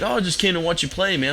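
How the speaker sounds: put-on breezy teenage boy asking for a drink; kid voice. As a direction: pitch it way up like a carefree kid. Narrator voicing the neighbor kid.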